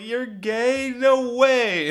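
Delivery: high, loud astonishment; exaggerated incredulous squeal; mock disbelief. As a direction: imitate a drunk who can't believe it. Drunk woman in disbelief.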